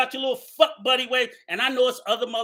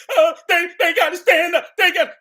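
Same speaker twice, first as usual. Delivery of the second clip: hammed-up pleading, higher and louder; mocking whine; 6 semitones higher, 7 dB louder than usual. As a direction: mimic a whining complainer. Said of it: "begging for Alan".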